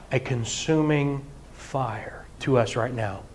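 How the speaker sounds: normal talking voice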